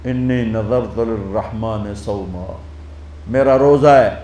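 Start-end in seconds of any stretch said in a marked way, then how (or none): 0.0-2.6 softer and lower, slow, finger to lips; quiet vow of fasting; hushed. Retold or imitated. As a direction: say it softly as a vow of silence. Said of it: "انگلی رکھ کر کہا".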